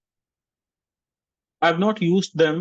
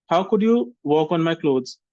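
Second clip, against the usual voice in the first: ordinary talk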